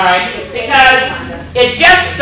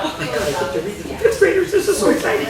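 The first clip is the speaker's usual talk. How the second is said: excited; 4 semitones higher, 6 dB quieter, much faster than usual. Retold or imitated. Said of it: "And so I thought".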